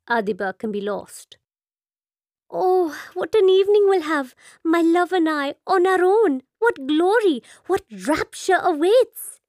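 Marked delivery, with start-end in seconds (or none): 2.5-9.4 high, loud rapturous gush. Acted. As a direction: gush with florid delight. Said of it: "sows her florid hopes".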